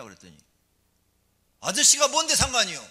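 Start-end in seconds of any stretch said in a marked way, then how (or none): 1.6-2.8 put-on high, loud, insolent child's comeback; cheeky kid's retort. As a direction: shout back like a defiant schoolboy. A child talks back to the preacher.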